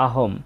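"আহম" closed on m without a vowel; half-m ending. Shown as a demonstration. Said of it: "অর্ধেক ময়ের মতো উচ্চারণ হয়".

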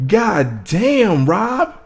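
high-pitched, drawn-out disbelief; impressed high exclamation; hyped amazement. Real, not acted. Amazed reaction to the bars.

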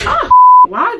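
sudden high, loud yelp of surprise; catching herself mid-trip; startled yelp. Real, not acted. Vlogger stumbling mid-story, startled high loud yelp.

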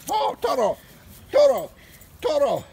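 high, loud exclaimed calls; calling out. Acted. Dog on his walk hollering for Toto, calling out. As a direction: call the name out, high and loud.

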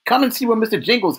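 a man who forgot where he is, thrilled; laughing, amazed. A narrator's reading. Delacroix calls the guards over, laughing and amazed. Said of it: "the laughing, amazed voice".

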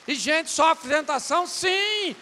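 loud, slow sermon shout; slow, loud preacher's declaration; full-force preaching. Acted. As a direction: hammer each word from the pulpit.

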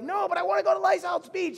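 high-pitched imitation of a whiny little boy; insistent young child. Young son arguing with parents about the beach, high childish protest. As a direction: put on a small kid's protesting voice. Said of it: "and he'd be like".

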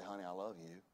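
soft, hushed loving words; quiet tender I love you. Pretend. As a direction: disarm the fight gently. Soft reply to quarrelling spouse.

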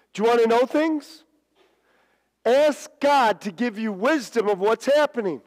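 high, loud preacher's challenge; urgent pulpit exhortation; emphatic preaching. Real, not acted. Preaches to the congregation.

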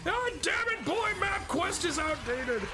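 high, hammed-up scolding dad; angry father voice. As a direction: scold the boy theatrically. Scolding the boy as Kratos.

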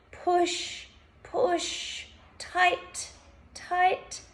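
high, effortful one-word commands; urging to tense. Shown as a demonstration. Instructor urging listeners to push fists down, urging to tense.

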